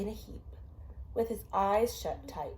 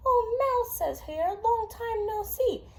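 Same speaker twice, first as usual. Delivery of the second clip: much higher, friendly hare greeting; 14 semitones higher, 4 dB louder than usual. Acted. Parent voicing the hare to a child, higher friendly voice. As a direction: greet an old friend in a high bright creature voice.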